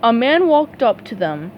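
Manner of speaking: plain reading voice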